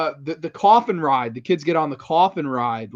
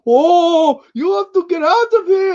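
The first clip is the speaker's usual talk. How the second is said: put-on very high, odd-accented monster cry; weird monster voice; 13 semitones higher, 5 dB louder than usual. Guy imitating a costumed park monster warning kids, high weird voice. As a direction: open with a loud Oh, then stay pitched way up. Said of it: "a little weird accent".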